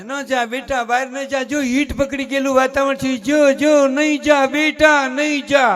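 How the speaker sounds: fussing mother, slow at the end; doting mother pleading. Retold or imitated. Doting mother keeps child inside.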